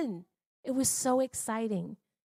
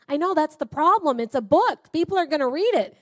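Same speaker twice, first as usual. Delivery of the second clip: loud, higher, exasperated pushback; retold anxious protest; 7 semitones higher, 10 dB louder than usual. Wife protests to her husband that people will read it, loud and anxious. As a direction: blurt out the fear of being read.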